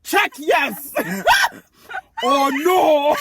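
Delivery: put-on loud Nigerian accent cracking with laughter. Eight-year-old asking Peter out, loud Nigerian accent. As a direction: belt out the note in a thick Nigerian accent. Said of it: "I still had an accent from Nigeria".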